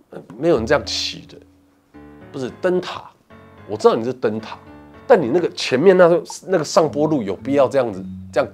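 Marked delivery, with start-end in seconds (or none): none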